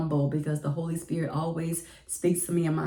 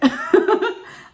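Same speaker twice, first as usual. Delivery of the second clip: high squealing laugh; 9 dB louder than usual. Real, not acted. Recalling finally calling the principal back, high giggling laugh. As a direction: burst of high nervous laughter. Laughing at her own nerves.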